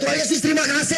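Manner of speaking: high reenacted cry of thanks to God; shouted thanks. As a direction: cry out thanks as if running down a corridor. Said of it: "Saya lari, teriak". (teriakan syukur bernada tinggi)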